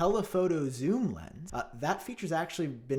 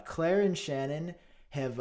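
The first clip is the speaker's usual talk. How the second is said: usual speaking voice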